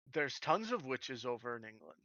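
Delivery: ordinary talk, nothing marked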